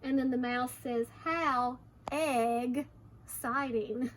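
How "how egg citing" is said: very slow, syllables pulled apart; drawn-out pun. Acted. Mouse jokes about eggs, slowly drawn out. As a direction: stretch the joke word out slowly.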